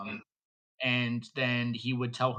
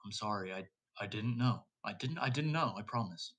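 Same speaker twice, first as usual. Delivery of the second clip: much softer, pleading apology upward; hushed prayer; 3 semitones lower, 9 dB quieter than usual. Acted. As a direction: murmur it like a guilty confession to heaven. Whispered prayer to the sky.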